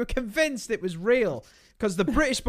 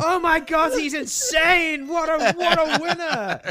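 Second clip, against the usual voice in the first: high, slow starstruck gushing; hammed-up hero worship; awestruck fan; 9 semitones higher, 4 dB louder, slower than usual. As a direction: rave like a dazzled kid fan. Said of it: "and we're like".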